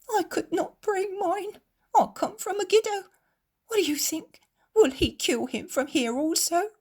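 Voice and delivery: high, tearful moaning plea; moaning woman. Acted. Grieving mother asks the crowd, moaning and tearful. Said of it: "moans a woman whose face is red with weeping".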